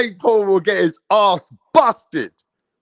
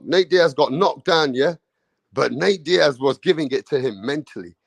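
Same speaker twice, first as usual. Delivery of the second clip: ordinary talk, nothing marked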